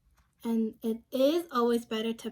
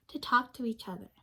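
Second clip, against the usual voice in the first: ordinary delivery, nothing marked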